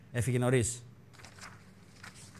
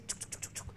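sneaky tsuk sounds; 18 dB quieter than usual. Retold or imitated. Mimicking sneaking through.

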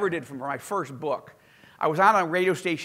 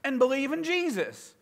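high dude voice, baffled; dude radio host; 9 semitones higher than usual. Retold or imitated. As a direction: ask it like a puzzled rock DJ. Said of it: "he's like".

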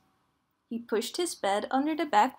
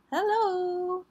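high, small, drawn-out call; little voice calling; 7 semitones higher than usual. A narrator's reading. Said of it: "heard a little voice cry".

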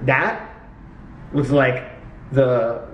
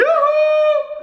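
teasing cheer; 4 dB louder than usual. Pretend.

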